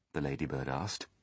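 usual speaking voice

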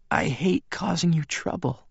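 high, frail, regretful woman's voice; Bella. A narrator's reading. Bella hates causing her visitor trouble, high frail voice. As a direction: pitch it high and weak, sorry to impose.

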